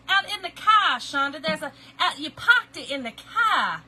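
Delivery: mock Boston accent, laughing through it. Laughing impression of a Boston friend's accent. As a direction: tease a friend with her Boston vowels. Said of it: "you do have an accent".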